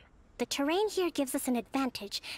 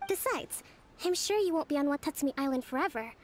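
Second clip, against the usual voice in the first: usual speaking voice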